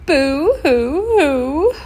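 high, loud, drawn-out mock wail; pitiful crying. A narrator's reading. Dwayne crying pitifully over failing his friend, hammed-up wail. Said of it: "cried as pitifully as any polar bear".

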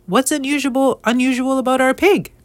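baffled wife wanting an explanation; puzzled wife. Acted. Wife questions husband's strange news.

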